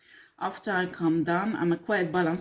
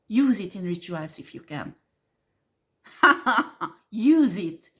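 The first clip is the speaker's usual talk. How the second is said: laughing it out, louder; laughing taunt; 4 dB louder than usual. Real, not acted. To occultists using her face, a laughing taunt. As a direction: dare them through a laugh. Laughing while daring the ritualists.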